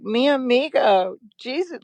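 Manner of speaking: sing-song it like a doting movie mom; high lilting imitation of a mother; imitating the movie mother. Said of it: "like the mother in the movie".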